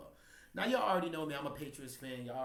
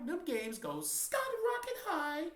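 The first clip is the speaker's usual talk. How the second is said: high sing-song; 4 dB louder, slower than usual. Real, not acted. Hyping up ticket prices.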